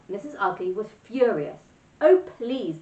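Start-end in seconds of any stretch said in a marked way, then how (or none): 2.0-2.8 high, pleading wail, louder; hammed-up girl's wailing plea. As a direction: beg through a mock wail. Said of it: "Gertie wailed".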